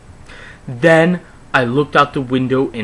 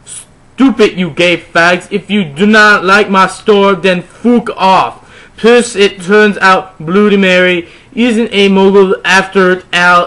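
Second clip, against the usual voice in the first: 1 mock angry rant; 5 semitones higher, 6 dB louder than usual. Reading a character's rant aloud.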